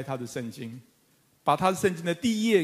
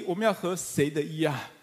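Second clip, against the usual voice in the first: ordinary talk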